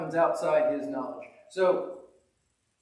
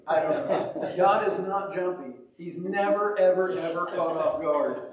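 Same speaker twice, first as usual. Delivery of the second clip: usual speaking voice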